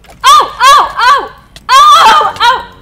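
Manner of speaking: loud alarmed yelps; panicked shouting. Real, not acted. Panicked shouting under attack.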